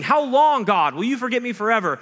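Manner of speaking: high, loud desperate yell; yelling at God. Shown as a demonstration. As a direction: throw the question up at heaven. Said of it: "teaches us to yell at God".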